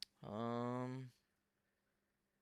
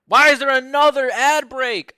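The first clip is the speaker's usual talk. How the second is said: much higher and louder, fed up; exasperated outburst; 10 semitones higher, 15 dB louder than usual. Real, not acted. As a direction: burst out at the ads. Streamer furious at ad breaks.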